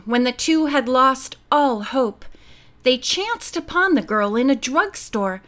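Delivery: ordinary delivery, nothing marked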